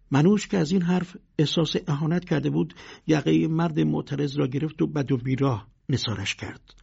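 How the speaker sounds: ordinary delivery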